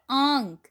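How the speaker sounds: आंग said with a long nasal ang; nasal sound demo. Shown as a demonstration. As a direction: hold the आंग nasal, drawn out. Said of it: "बिंदु की ध्वनि कैसी होती है?". (आंग को नाक से लंबा खींचकर बोलिए)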